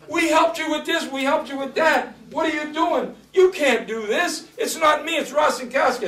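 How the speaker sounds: very high, agitated pleading; mimicked frantic protest. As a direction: imitate flustered officials protesting high up in the voice. Mimics panicked officials protesting.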